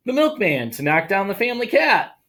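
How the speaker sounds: animated storytime narration; story reading. Acted. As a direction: read it like a children's picture book. Reads picture book aloud.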